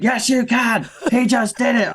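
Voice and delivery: put-on high, indignant little-boy retort; mock kid's protest, voice pitched right up; imitating arguing child. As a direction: mimic a brother insisting back in a squabble. Voices son arguing back.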